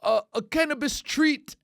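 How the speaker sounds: put-on high, hesitant French-accented confession; loosely put-on French accent; mock French accent. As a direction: imitate a sheepish Frenchman. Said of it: "some French guy".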